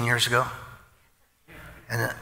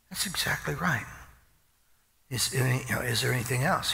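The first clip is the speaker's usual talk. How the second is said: soft half-whispered clerk; hushed reply; 2 semitones higher, 5 dB quieter than usual. Retold or imitated. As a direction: answer under the breath. Clerk answering softly.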